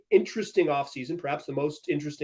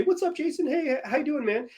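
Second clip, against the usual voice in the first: higher, lively mock hello; imitating a friendly greeting; 9 semitones higher, 3 dB quieter than usual. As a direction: play the buddy greeting up high. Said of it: "you say".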